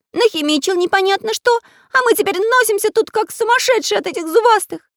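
high, loud, fast cartoon complaint; disgruntled pencil character. A narrator's reading. Pencil character griping about fleeing toothy plants, disgruntled. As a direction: pitch it way up, griping loudly. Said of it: "недовольно пробурчал Карандаш".

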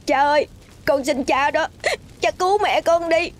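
high tearful plea to a father; weeping child's high entreaty; desperate begging. A narrator's reading. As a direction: beg through sobs. Boy begs his father for help.

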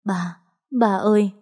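understated, grief-choked call to a dead father; narrated sob, first word faint, then rising; choked sobbing call. A narrator's reading. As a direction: call out for Dad with a touch of a sob. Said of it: "cất tiếng gọi cha trong nức nở nghẹn ngào".

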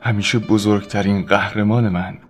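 usual speaking voice

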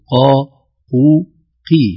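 قا heaviest, قو medium, قي lightest; three qaf sounds from heavy to light; qaf grades. Shown as a demonstration. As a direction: say قا, قو, قي with the ق growing lighter each time. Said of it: "أعلى تفخيم لها في الفتح".

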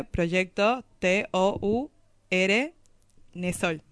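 slow, letter by letter dictation; spelling it out. Shown as a demonstration. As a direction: spell each letter clearly for the listeners. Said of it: "Se escribe". (deletreando despacio, letra por letra)

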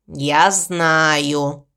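low, glum crow; gloomy. A narrator's reading. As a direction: admit it glumly, voice dropped low. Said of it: "Молчаливый и мрачный". (мрачно, низким голосом)

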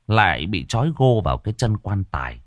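plain reading voice

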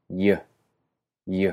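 Y with no friction like English y. Shown as a demonstration. Teacher shows the Icelandic j sound. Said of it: "mínima o ninguna fricción".

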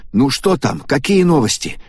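high, quick eager blurt. Acted. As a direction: burst out with the question. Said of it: "выпалил Такааки".

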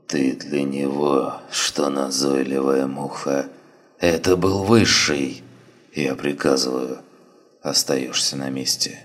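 weak, slow, commanding dying voice; dying commander ordering. Acted. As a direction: give the order through pain. Dying man speaking faintly.

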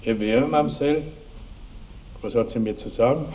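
high, sing-song mock politeness; arch questioning. Baron questioning his bride caught with another man, high arch questioning. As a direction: ask with affected sweetness.

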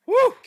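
sudden loud "woo"; exclaimed woo. Real, not acted. Host marvelling at thirty to seven, exclaimed woo. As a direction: let out a sharp woo.

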